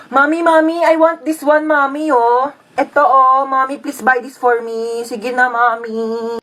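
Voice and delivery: put-on a child nagging mommy for a toy; begging child.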